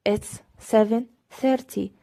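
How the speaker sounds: slow, clear teacher demo; deliberate classroom pacing; slow model reading. Shown as a demonstration. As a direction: say it slowly for learners. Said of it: "slow full model".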